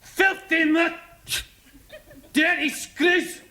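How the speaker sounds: high, loud, slow Scottish yelling; furious Scotsman. Retold or imitated. Scottish prisoner shouts at the guards, high and slow. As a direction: rage at the guards like a caged Scot. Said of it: "this Scotsman".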